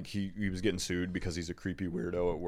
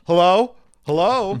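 loud, much higher hello; fake voicemail hello; 11 semitones higher, 14 dB louder than usual. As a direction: call out like a fake live answer. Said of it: "the person goes".

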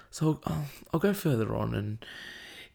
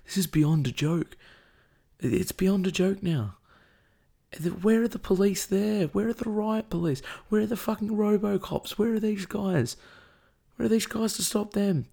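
emotional; 7 semitones higher than usual. Real, not acted. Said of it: "I'm sorry to get so emotional".

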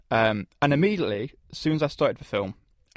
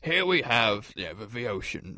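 nature documentary; 5 dB quieter than usual. Retold or imitated. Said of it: "wildlife narrator voice where it's like".

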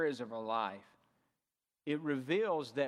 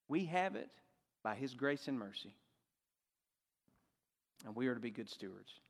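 usual speaking voice

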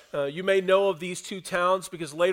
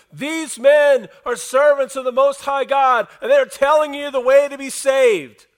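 high, full-force shouted proclamation; screaming; 7 semitones higher, 11 dB louder than usual. Acted. Demon in a slave girl trailing Paul, screaming. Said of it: "it says she was screaming this".

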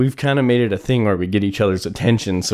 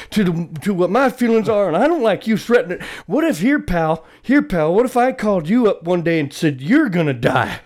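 mock angry rant; 9 semitones higher than usual. Chewing out the scam caller, hammed-up rant. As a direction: berate him like an offended atheist.

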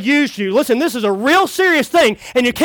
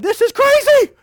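high-pitched mock outburst; exasperated exclamation; 10 semitones higher than usual. Preacher breaking off two-timing routine, exasperated.

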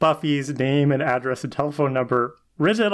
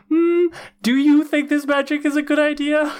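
much higher, fussy prim worrier voice; mock Giles; 11 semitones higher than usual. Co-host mocks Giles doubting Willow's magic, prim imitation. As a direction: lampoon a fretful British mentor. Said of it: "Giles ... has been like".

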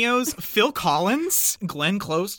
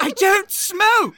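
put-on prim old lady voice; 11 semitones higher, 6 dB louder, slower than usual. Repeating the Maggie Smith line.

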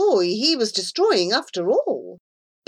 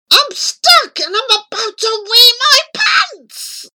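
desperate yell; 14 semitones higher, 7 dB louder than usual. A narrator's reading. Desperate boy locked in office.

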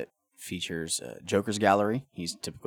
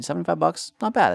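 mock-impressed; 10 semitones higher, 7 dB louder, faster than usual. Mock praise of jersey price.

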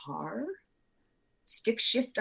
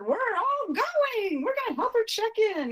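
excited; 14 semitones higher than usual. Retold or imitated. Announcing the trip to daughters.

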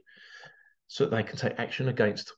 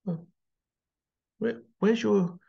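pitched-up mock-innocent questions; feigned puzzlement, higher voice; sarcastic; 7 semitones higher than usual. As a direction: play dumb, dripping with sarcasm. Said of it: "Yes, it's sarcasm".

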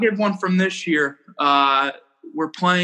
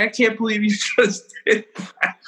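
higher-pitched mock disbelief, breaking into a laugh; imitating laughing teammate; 6 semitones higher than usual. Shortstop teasing the pitcher in the dugout, retold laughing. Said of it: "my shortstop's laughing at me".

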